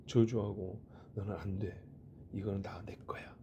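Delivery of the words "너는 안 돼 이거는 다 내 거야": low, hushed imitation of a bully; mock menacing. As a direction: play an older brother threatening under his breath. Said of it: "아주 험악한 말을 하면".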